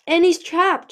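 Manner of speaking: loud, high burst of words; sudden outburst. A narrator's reading. Moon confesses about the trapped dragon, words suddenly spilling out. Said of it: "words suddenly spilling out like smoke".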